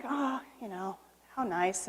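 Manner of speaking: quiet, tender "how nice"; softly admiring the family Christmas tree; soft fond sigh. Retold or imitated. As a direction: murmur it to yourself, fondly.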